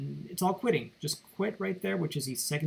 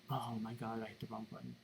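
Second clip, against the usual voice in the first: low, very soft self-reproach; hushed, dropping away under the breath; muttered aside; 5 semitones lower, 14 dB quieter than usual. Real, not acted. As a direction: mutter it to yourself after a slip. Mistake mid-demonstration, muttered aside.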